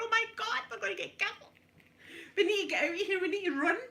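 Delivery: high frightened pleading cry; urging escape from the cows; panicked. Retold or imitated. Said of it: "I'm going".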